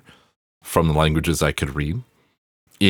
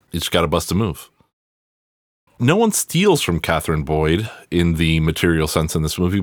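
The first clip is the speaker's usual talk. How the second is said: ordinary talk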